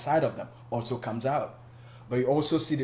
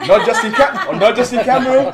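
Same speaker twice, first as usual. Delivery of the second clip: laughing, higher and louder; 10 semitones higher, 15 dB louder than usual. Real, not acted. Guest laughing back at the host's teasing. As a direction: agree while cracking up.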